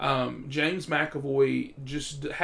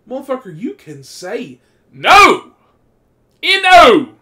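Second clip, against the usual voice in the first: loud, drawn-out frustrated shout; exasperated rant shout; exasperated yell; 9 semitones higher, 17 dB louder, slower than usual. Real, not acted. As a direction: yell it at friends who never refuse. Ranting at people who can't refuse.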